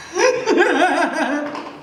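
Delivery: a burst of startled laughter; nervous laugh. Real, not acted. Chameleon lands on her brush.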